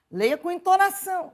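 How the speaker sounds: imitated mother's high, loud instruction; coaching mother. Mother coaching her daughter to read aloud, emphatic imitation. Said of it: "ela sempre pegava e dizia assim".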